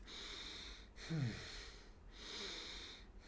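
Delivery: soft but audible breaths from the chest; shallow chest breathing. Shown as a demonstration. Teacher showing beginners a breath from the chest, shallow breathing. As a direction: demonstrate shallow, airy breathing without words. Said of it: "breathing quite shallow from the chest".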